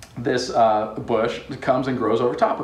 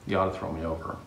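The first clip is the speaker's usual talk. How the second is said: resigned murmur; 8 semitones lower, 8 dB quieter than usual. Acted. Jonah resigned on the boat.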